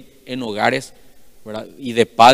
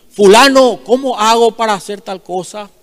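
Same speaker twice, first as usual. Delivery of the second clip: calling out loud and high to the next office; shouted question; 8 semitones higher, 7 dB louder than usual. Retold or imitated. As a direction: yell the question across the room. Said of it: "tenía el mal hábito de hablar fuerte".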